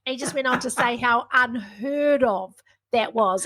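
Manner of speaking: ordinary delivery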